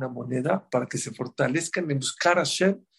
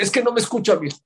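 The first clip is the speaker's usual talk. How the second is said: complaining parent; 7 semitones higher, 5 dB louder, faster than usual. Retold or imitated. Parent complains child won't listen.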